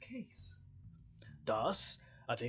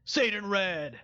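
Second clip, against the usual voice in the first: commanding shout; 5 dB louder than usual. Acted.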